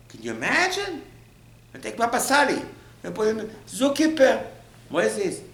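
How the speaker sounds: high, hammed-up disbelief; incredulous. Acting disbelief at the lowly job.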